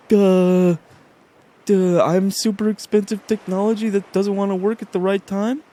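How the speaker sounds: loud, high, dopey mock voice; hammed-up stupid piece of technology; dim-witted app. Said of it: "every app is like".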